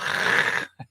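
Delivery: long throaty "kh" rasp; Dutch guttural sound. Shown as a demonstration. Showing the sound picked up in Holland, throaty Dutch rasp. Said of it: "you know, from the Dutch".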